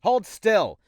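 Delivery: sharp high shout; shouted order. Acted. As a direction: bark the order loudly. Shouting at patient.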